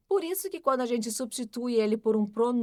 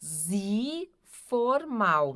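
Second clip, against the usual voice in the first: Zi formal drawn out very slowly; much slower than usual. Shown as a demonstration. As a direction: stretch Zi formal out. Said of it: "que é o formal". (Zi formal bem devagar)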